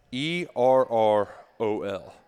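letters E R R O L spelled slowly one by one; spelling it out. Shown as a demonstration. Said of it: "his first name is spelled".

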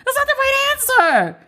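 very high squealing disbelief; outraged shriek. Real, not acted. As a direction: shriek it as if it can't be real. Repeats in disbelief.

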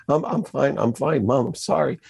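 retold tearful apology, high voice; tearful son. Son at his mom's door, imitated crying. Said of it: "I'm just crying in front of her".

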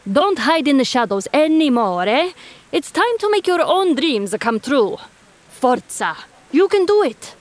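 rising Italian cheer, building to a high finish; Italian-accented self pep talk. A narrator's reading. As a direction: pump himself up in an Italian accent. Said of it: "he said to himself".

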